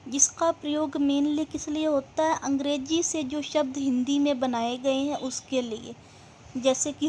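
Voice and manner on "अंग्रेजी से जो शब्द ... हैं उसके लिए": normal talking voice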